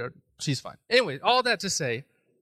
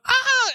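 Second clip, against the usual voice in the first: short, loud, high shriek; piercing mock scream; imitated scream; 9 dB louder than usual. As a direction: mimic a cornered wife's scream. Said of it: "she's screaming".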